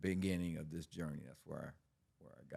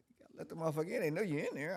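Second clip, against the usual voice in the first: urgent high retelling; 11 semitones higher, 3 dB louder, much faster than usual. Retold or imitated. Retold urging partner to open door.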